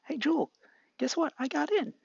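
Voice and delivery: excited Izuku. Acted. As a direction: blurt it out bursting with joy.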